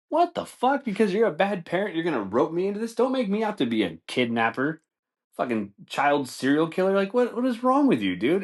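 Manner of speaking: high-pitched incredulous rant; indignant, voice shooting up in disbelief; outraged protest. Acted. Waiter protesting being roped in as kidnapper, acted outrage.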